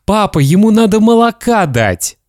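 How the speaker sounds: put-on high child voice; boy's plea. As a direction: ask dad eagerly, like a little boy. Boy asks milk for hedgehog.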